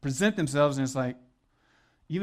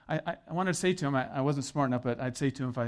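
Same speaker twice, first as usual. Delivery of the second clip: normal talking voice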